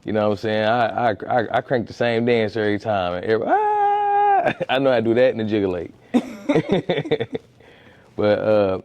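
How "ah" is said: long, very high "ah"; high drawn-out yelp. Shown as a demonstration. Showing his one dance move, playful high yelp. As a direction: let out a sustained high squeal.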